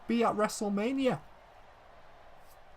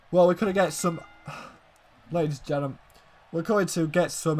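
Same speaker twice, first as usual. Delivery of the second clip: normal talking voice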